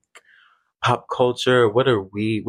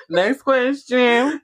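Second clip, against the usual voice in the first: imitating celebrity; 10 semitones higher, 3 dB louder, much slower than usual. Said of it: "You said next question".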